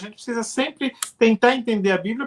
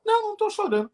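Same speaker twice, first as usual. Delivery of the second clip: negação aguda, encenada; negação do choro; 12 semitones higher, much faster than usual. Acted. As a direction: encenar quem nega depressa que chora, voz mais aguda. Encena quem nega o choro. (negação defensiva em voz aguda)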